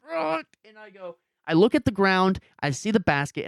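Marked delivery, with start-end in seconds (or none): 0.1-0.5 a sharp high ugh of frustration; retold frustrated groan, pitched high; high mock groan. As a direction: squeak out a pained ugh.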